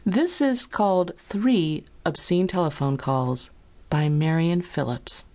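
usual speaking voice

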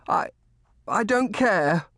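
put-on high, halting boy's outburst; stammer, then blurt it stubbornly. A narrator's reading. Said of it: "Colly blurted at last".

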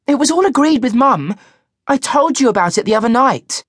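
put-on high teenage daughter voice; young girl voice. A narrator's reading.

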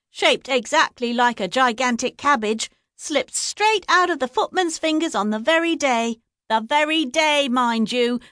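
high busybody telling a scandal; gossipy village woman. A narrator's reading. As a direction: savour every juicy detail. Gossip tells tale of curse.

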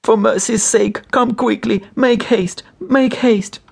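very high, anguished plea; tearful pleading. A narrator's reading. Desperate wife begging the doctor to hurry, tearful pleading. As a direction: beg urgently through held-back sobs. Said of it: "restraining her sobs".

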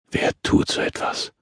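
low-volume, voiceless mutter; murmured. A narrator's reading. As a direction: murmur it under your breath. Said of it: "murmelte der Mann".

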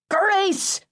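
high, exasperated mother's shout. A narrator's reading. Mother cutting off her arguing sons, shouting. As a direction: shout it high to end the squabble. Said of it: "my mother shouted".

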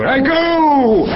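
high, loud triumphant shout; loud exit cry. Acted. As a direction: bellow it grandly.

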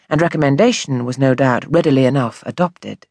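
ordinary delivery

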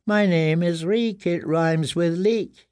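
slow, high, cowed voice; tearful recital. A narrator's reading. Prisoner repeating the name taught him, tearful and slow. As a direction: recite it slowly in a thin, whimpering voice. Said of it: "Tears ran down his cheeks".

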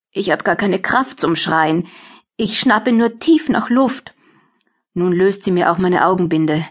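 plain reading voice

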